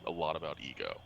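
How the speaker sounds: soft, low confidential aside; hushed in-character undertone; whispering. Acted. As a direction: keep it between the two of you. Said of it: "he's gonna whisper as quietly as he can".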